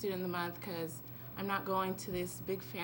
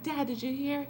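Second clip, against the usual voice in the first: high, eager call to dad; bursting with news, higher pitch; excited girl asking her dad; 7 semitones higher, 4 dB louder than usual. Retold or imitated. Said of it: "I was just so excited".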